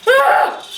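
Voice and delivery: sharp high shriek of alarm; startled scream. Real, not acted. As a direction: scream in sudden panic. Shock while repotting plant.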